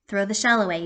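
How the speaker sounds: plain reading voice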